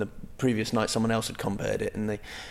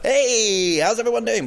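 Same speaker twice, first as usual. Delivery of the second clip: much higher, louder hype-man hello; high-energy greeting; 12 semitones higher, 6 dB louder than usual. Retold or imitated. Comedian matching a rowdy crowd's energy, loud and much higher. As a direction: pump up the crowd. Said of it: "match the audience's energy".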